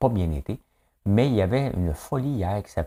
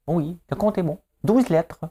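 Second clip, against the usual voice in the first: much higher, put-on quiz-show voice; game-show voice; 11 semitones higher, 3 dB louder than usual. Host parodying a word game show, high quiz voice. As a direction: announce the winning result in a high mock TV voice. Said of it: "Des lettres et des mots".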